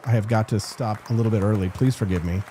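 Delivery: usual speaking voice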